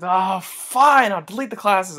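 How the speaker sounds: mild mock reluctance, caving in; grudging eye-roll. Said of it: "I rolled my eyes like".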